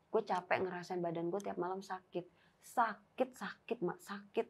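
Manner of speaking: softly voiced, tired confession; retold weary complaint. Friend confides her nightly pain, weary and hushed. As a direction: quietly imitate a worn-out friend.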